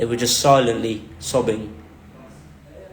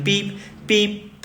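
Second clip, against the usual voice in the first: imitated beeping; 6 semitones higher, 5 dB quieter than usual. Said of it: "this noise is getting faster".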